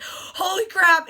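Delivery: high, loud, drawn-out exclamation; thrilled group exclamation; excited gasp. Retold or imitated. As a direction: gasp it as you arrive. Group exclaiming as bus slows.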